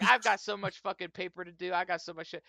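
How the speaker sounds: high, loud overwhelmed babble; flustered excuse. Acted. Researcher pleading paperwork to the officer, flustered and high.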